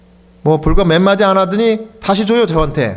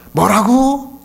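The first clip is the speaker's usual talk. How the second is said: a pastor imitating his half-deaf father on the phone, raised and higher; imitating hard-of-hearing father's raised voice; 7 semitones higher than usual. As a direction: play the deaf old father calling out to be heard. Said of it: "큰 소리로 이야기하면서".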